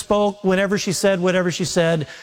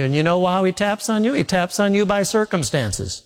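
normal talking voice

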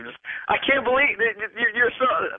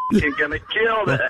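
ordinary talk, nothing marked

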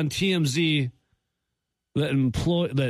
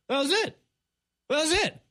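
incredulous; 12 semitones higher than usual. Real, not acted. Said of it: "disbelief, high pitched".

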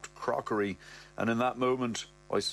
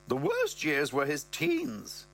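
higher, slow mother voice; mother's voice; 6 semitones higher, slower than usual. Acted. Mother complaining to Brett, in a slow higher voice. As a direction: draw out the mother's line, pitched up. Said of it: "she goes".

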